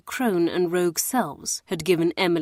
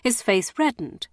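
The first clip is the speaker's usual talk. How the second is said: usual speaking voice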